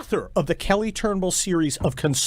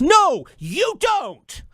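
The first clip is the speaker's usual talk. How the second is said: loud, slow, higher retort; emphatic shout; 10 semitones higher, 4 dB louder, much slower than usual. Real, not acted. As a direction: slap the excuse down hard. Angry rebuttal.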